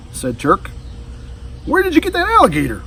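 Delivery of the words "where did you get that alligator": put-on a boy amazed. A narrator's reading. Turk asks about alligator.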